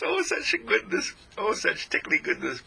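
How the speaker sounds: delighted. Real, not acted. Delighted exclamation.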